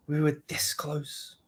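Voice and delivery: slow hushed whisper of a film line; whispered movie quote. Retold or imitated. Streamer quotes Rogue One line, slow whisper. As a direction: breathe it out like a tense movie moment. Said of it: "Sorry to quote Star Wars".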